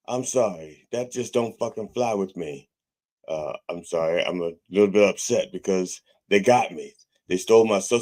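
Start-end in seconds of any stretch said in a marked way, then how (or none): none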